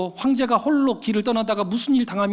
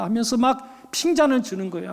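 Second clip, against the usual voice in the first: ordinary delivery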